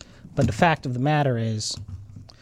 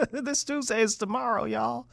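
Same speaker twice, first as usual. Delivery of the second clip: laughing; 11 semitones higher than usual. Real, not acted. Laughs at own joke.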